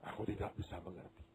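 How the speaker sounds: grieving mother, softly; sad. Acted. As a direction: murmur it in sorrow. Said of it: "Dengan sedih ibunya berkata".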